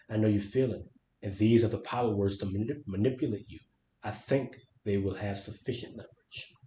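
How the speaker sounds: quiet, steady book reading; story narration. Acted. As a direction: read the passage softly to listeners. Continues reading the passage.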